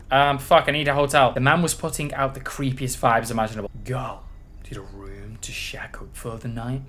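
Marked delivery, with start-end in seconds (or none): 3.8-6.8 slow, low creepy whisper; creepy motel man. Acted. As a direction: whisper it slowly like a lurking creep.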